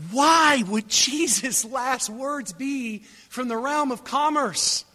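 high, slow incredulous question; baffled questioning. Acted. Preacher puzzling over Jesus' last words, incredulous.

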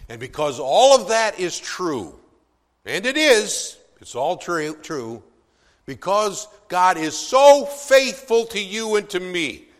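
loud, high, ringing pulpit declaration; preaching at full force. Acted. Preacher affirming God's faithfulness to the congregation, loud and soaring. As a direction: build it up and proclaim it to the congregation.

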